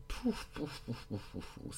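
high squeaky pouf pouf sound effect; playful pouf sounds. Pretend. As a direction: pop out silly pouf noises.

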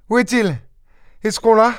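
high-pitched, urgent questions; anxious questioning. A narrator's reading. Wounded wife asking whether thief is caught, anxiously. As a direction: ask eagerly in a high woman's voice.

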